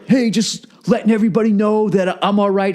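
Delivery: a hint of a smug guy reassuring his followers; mocking a selfie video. Mocking a poser filming himself for followers, lightly voiced. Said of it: "douchebags who were taking videos of themselves".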